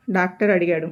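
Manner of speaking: ordinary delivery, nothing marked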